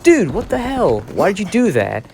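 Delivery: mock outraged at the thief; retold outrage. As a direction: snap at the kid in high indignation. Angry at the kid.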